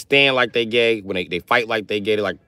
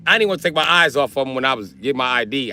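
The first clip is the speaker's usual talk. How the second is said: normal talking voice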